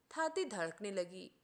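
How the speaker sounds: usual speaking voice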